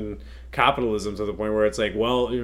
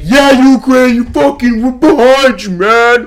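very high, loud rallying yell; over-the-top fake hype; mock cheering; 13 semitones higher, 14 dB louder, slower than usual. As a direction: shout hammed-up support for a pal. Acting two-faced countries cheering Ukraine.

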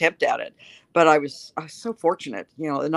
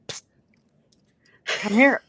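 put-on old lady beckoning; 6 semitones higher than usual. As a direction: call her over conspiratorially. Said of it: "the little old lady said".

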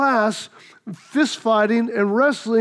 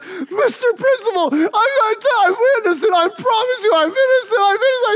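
crying plea; 11 semitones higher than usual. Retold or imitated. Boy throwing himself on principal's desk, hammed-up tearful wail. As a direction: sob it out to the principal. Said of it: "threw myself onto his desk".

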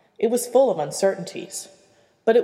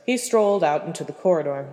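ordinary delivery

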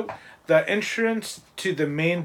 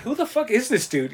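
baffled high exclaim; 7 semitones higher than usual. Pretend. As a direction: pitch jumps up in disbelief. Imagined baffled reaction.

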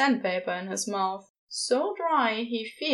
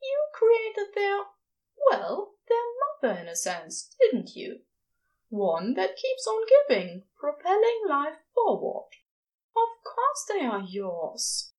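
higher, earnest angel voice; insistent angel; 10 semitones higher than usual. Acted. Angel insists the new stars are Crowley's, earnest and higher. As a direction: press the point gently but surely.

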